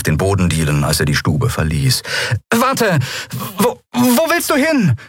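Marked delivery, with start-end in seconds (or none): 2.5-5.0 high, flustered call; urgent calling. Acted. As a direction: stumble over the question as you call after him. Calling after him as he leaves.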